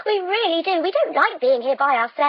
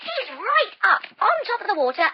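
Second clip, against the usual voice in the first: usual speaking voice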